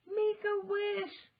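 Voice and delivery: high, slow urgent call; urgent cry. A narrator's reading. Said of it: "cried Annie".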